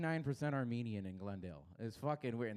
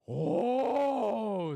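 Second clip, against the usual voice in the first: high, loud offended oh; loud shocked gasp of a wife; wife's outraged oh; 10 semitones higher, 10 dB louder than usual. Retold or imitated. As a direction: blurt it like an insulted wife. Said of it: "says the wife".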